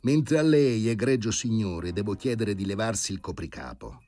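solemn and pointed; grave. A narrator's reading. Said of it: "con aria grave". (tono grave e solenne, più alto)

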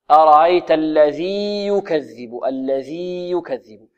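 slow الذي with long ī, then يكذب; separated ya. Shown as a demonstration. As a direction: recite الذي يكذب unhurried, the two ya apart. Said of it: "لا تدغم". (الذي يكذب بإظهار الياء)

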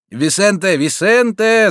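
loud, rising shout; shouting a name. A narrator's reading. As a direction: call him off before he strikes. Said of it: "закричал бандит".

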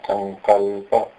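संकल्प slowly, कल् weighted; stress on कल्. Shown as a demonstration. Teacher modelling संकल्प for the student. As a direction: stretch संकल्प and hit कल्. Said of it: "कल्प के भी क में जो अ है".

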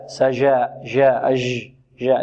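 low جاء with the jim not stopped; faulty jim. Shown as a demonstration. Teacher imitating the mistake before rejecting it, faulty soft jim. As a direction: say سجى and جاء with a loose, leaking j. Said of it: "لا".

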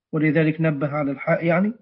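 ordinary talk

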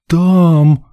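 high, loud melodramatic cry; theatrical outburst; agitated. A narrator's reading. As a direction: wail it out like an overwrought lady. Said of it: "взволнованно прижав руки к груди".

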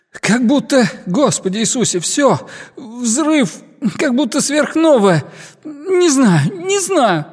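high, horrified cries in broken bursts; panicked, near tears. Acted. Major describing the blast to flight control, panicked and near tears. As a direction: break down while trying to describe it.

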